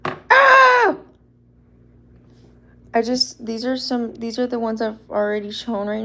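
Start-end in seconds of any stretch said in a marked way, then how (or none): 0.0-1.0 high, loud squeal; excited squeal. Real, not acted. Showing customs to live viewers, excited squeal. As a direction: let out a piercingly high "ah".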